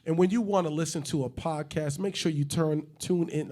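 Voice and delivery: hyped-up ad read, a bit high and loud; promo read. Acted. Host plugging the podcast, upbeat promo voice. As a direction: sell the podcast like a radio spot. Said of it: "hype promo".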